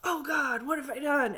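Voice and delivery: a mild hammed-up horror at what he made; horrified gasp. Frankenstein sees the creature.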